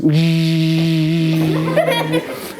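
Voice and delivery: long, low, steady motor hum; imitated seat motor. Dad showing the car seat buttons at work, droning hum. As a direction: drone like a power seat moving.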